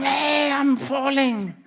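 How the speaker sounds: high, loud, drawn-out cry of someone falling; stretched, high-pitched yell; mock scream. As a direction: call out as if plummeting past a friend. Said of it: "if you scream".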